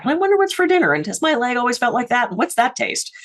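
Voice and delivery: high-pitched stream of stray thoughts; chattering brain. Acted.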